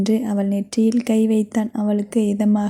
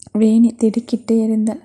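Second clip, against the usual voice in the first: ordinary delivery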